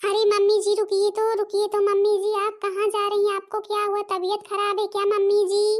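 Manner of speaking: loud, high urgent shout; daughter-in-law shouting after departing mother-in-law; shouting after her. Acted. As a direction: yell to stop her leaving. Chasing, shouting to stop her.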